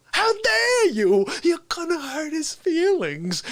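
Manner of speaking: high, slow, indignant shriek-voice; offended Fauci. Retold or imitated. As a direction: take mock offense for your invisible friend. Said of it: "And then Fauci goes".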